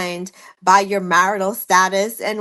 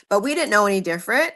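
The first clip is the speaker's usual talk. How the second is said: ordinary delivery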